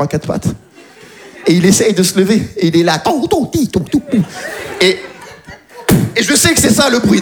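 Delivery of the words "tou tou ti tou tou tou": high, light patter sounds; imitated baby crawl patter. Comedian mimics the baby crawling to the crowd, high patter. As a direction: tap out the crawl in high little syllables.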